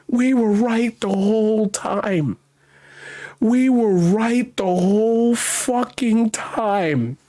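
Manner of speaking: drawn-out indignant vindication; slow, emphatic. Real, not acted. Host realizing his side was right, slow and emphatic. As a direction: stretch every word in exasperation. Exasperated vindication, repeated slowly.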